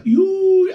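high, loud squeal of mock shock. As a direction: squeal "iui" at juicy gossip. (țipăt ascuțit de uimire prefăcută)

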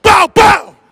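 high, loud shouted pop pop; shouted ad-lib. Shown as a demonstration. Host shows a passerby the ad-lib, loud shout. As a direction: bark the ad-libs like a drill rapper. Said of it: "With your chest".

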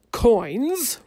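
a loud, outraged roar; roaring king. A narrator's reading. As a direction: roar the word like an angry king. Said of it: "roared the king".

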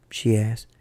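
usual speaking voice

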